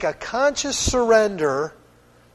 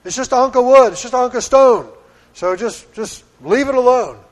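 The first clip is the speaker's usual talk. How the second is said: mock dismissive decree; 5 semitones higher, 6 dB louder than usual. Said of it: "He didn't just say".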